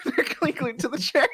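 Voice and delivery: amused, laughing through the words. Real, not acted. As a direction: crack up while saying it. Player cracks up at glued gnome.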